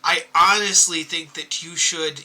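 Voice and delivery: apologizing gently to the crying giant; sincere apology. Acted. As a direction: say sorry softly and earnestly. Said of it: "I would just go over and be like".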